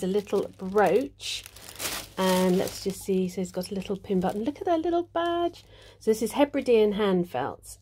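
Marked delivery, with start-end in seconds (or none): none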